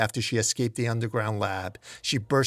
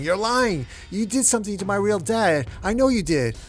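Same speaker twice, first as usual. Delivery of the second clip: high, louder furious scream of denial; 8 semitones higher, 3 dB louder than usual. A narrator's reading. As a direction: scream it, refusing to believe.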